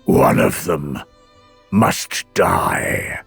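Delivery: low, slow pronouncement with weighty pauses; grave decree. A narrator's reading. God sentences heroes before the assembly, grave decree.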